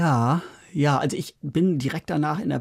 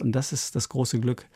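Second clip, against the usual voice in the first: ordinary delivery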